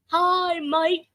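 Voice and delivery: child's playful attempt at an imitated accent; Chaka's accent. Child saying hi to the host in chat, in a mock accent. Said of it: "That's not my accent".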